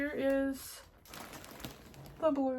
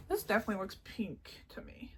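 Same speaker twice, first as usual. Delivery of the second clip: whispering; 3 semitones lower, 7 dB quieter than usual. Real, not acted. Comparing yarn colours softly.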